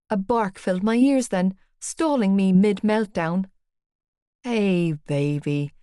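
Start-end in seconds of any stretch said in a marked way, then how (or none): 4.4-5.7 lower, slow and tender; cooing. A narrator's reading. Johnny greeting his dog, cooing tenderly. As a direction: coo to the dog lovingly. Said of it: "Johnny cooed".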